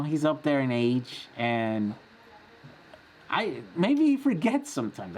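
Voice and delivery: ordinary talk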